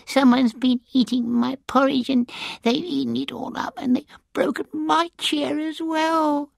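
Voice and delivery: a tiny bear whimpering over his chair; squeaky sobbing baby bear. Acted. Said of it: "he sobbed in his little squeaky".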